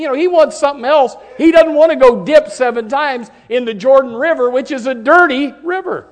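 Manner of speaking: loud, high, driving preacher voice; fiery preaching. Acted. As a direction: preach it with full force to the congregation. Preaching at full force.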